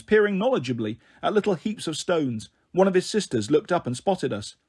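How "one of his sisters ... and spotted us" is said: usual speaking voice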